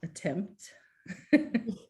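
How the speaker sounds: laughing through it. Real, not acted. Laughing while sharing screen.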